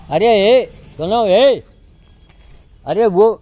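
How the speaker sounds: high loud shouts to get attention; calling out. Real, not acted. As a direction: yell across to someone distant. Calling out to someone far off. (ऊँची आवाज़ में पुकारना)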